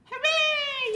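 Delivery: very high, loud cheering yell; crowd cheer. Acted. Crowd cheering the revived pig, loud yell. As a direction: cheer like a whole grandstand. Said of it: "yelled the crowd".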